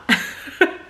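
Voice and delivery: breathy giggle; a short amused laugh; light laugh. Real, not acted. Laughs at own old plan.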